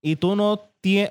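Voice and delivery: ordinary talk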